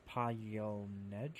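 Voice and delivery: usual speaking voice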